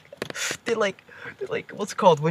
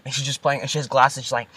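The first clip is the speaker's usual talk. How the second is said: ordinary delivery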